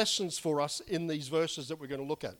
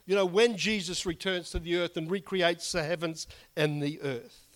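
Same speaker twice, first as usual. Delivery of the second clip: usual speaking voice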